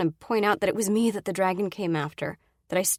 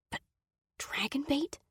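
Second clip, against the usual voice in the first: whisper; 8 semitones higher, 7 dB quieter than usual. A narrator's reading. Fearful realization, whispered.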